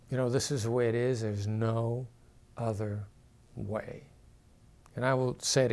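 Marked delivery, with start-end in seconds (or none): 0.3-4.1 each phrase falls and fades, softer at the end; downward inflection. Shown as a demonstration. Speaker demos a final, non-negotiable downward tone. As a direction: close the door with a falling tune. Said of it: "Downward inflection is often used to say".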